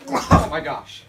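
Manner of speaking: loud gasping lead-in to a sneeze; sneeze coming on. Acted. Friend sees a sneeze coming, loud gasping build. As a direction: build up the sneeze, then let it go. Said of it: "Sorry, I'm gonna sneeze".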